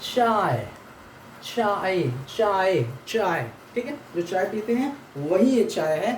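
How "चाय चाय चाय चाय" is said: चाय with a crisp "ch", repeated slowly; repeated pronunciation drill. Shown as a demonstration. Teacher drills the Russian letter ch for students, slow repetition. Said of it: "ये च का pronunciation है".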